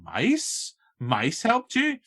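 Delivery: can't believe what he's hearing; incredulous newscaster. Retold or imitated. Newscaster to Penny about the mice, incredulous. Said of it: "And the guy's face, like".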